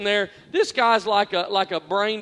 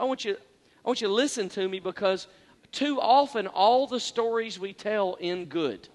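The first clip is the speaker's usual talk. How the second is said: ordinary delivery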